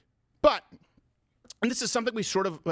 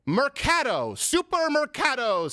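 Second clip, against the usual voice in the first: put-on Spanish accent; 8 semitones higher, much slower than usual. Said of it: "really good Spanish accent you got going there".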